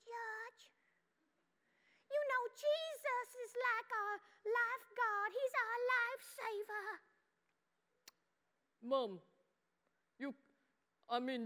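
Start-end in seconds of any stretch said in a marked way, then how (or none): 0.0-6.9 high, soft mommy falsetto; put-on high, gentle mom; Mommy's voice. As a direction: coo it in a tiny, high mother voice. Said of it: "well, she sounds like this".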